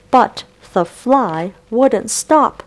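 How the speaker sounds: usual speaking voice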